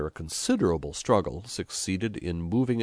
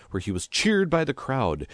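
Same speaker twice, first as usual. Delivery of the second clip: ordinary delivery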